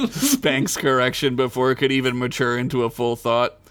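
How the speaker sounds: normal talking voice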